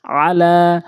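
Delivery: আলা with short আ and long held লা; recited আলা, last vowel drawn out; one-alif elongation on লা. Shown as a demonstration. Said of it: "মদের হরকত".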